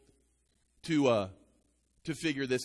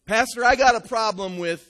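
loud griping phone caller; complaining caller; 6 semitones higher, 10 dB louder than usual. Retold or imitated. Church member complaining to the pastor on the phone. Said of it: "called me on the phone and said".

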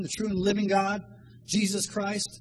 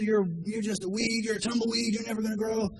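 mock drill sergeant; 4 semitones higher than usual. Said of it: "They get that drill sergeant".

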